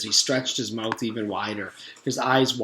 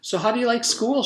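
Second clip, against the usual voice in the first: much higher, fast, sing-song dentist; bossy dentist; 12 semitones higher, 4 dB louder, faster than usual. Acted. Dentist urges the boy to open wider, much higher and faster. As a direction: coax him wider with fake sweetness.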